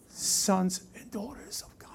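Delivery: hushed whisper of revelation; awed whispered words. Real, not acted. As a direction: breathe it out like a secret. Preacher drops to whisper.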